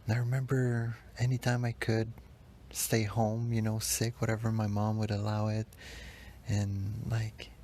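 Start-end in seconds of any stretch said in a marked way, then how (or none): none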